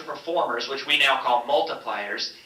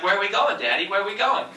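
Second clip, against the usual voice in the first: put-on higher, childlike voice asking a question; imitating toddler; 7 semitones higher, faster than usual. Toddler clinging to her dad's leg, childlike pleading questions. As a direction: play the little girl tugging at Daddy.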